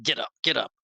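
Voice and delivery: ordinary talk, nothing marked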